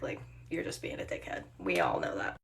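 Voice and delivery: very low, hushed deadpan; quiet, dismissive mutter; muttered aside. Real, not acted. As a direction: drop to an under-the-breath remark. Muttered aside about the customer.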